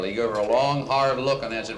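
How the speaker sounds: usual speaking voice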